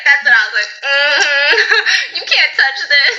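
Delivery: laughing through the words, pitch jumping high; giggling hard while telling it. Real, not acted. Host laughing as she tells friends about her bathrooms.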